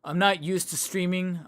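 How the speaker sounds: ordinary talk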